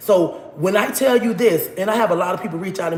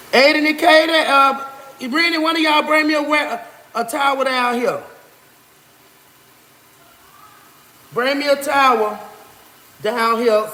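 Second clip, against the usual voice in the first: calling out; 6 semitones higher, 3 dB louder than usual. Real, not acted. Calling kids from another room.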